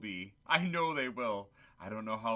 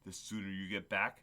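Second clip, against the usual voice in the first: ordinary delivery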